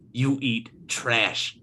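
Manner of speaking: slow, lightly voiced contempt; the Don. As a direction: drag it out with scorn. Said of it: "he's like".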